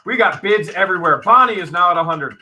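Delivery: loud, high, fast auction patter; auctioneer bid calling. Real, not acted. Host calling bids on the Barbie lot, auctioneer patter. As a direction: rattle off bids with rising urgency.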